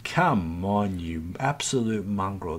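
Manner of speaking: ordinary talk, nothing marked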